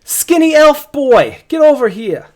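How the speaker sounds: put-on high, loud call, drawn out on skinny elf boy; laborer shouting. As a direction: yell at the elf to come help. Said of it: "he's like".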